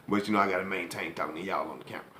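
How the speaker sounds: soft whispered aside; whispering. Real, not acted. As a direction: drop to a whisper for a quick aside. Aside to the camera.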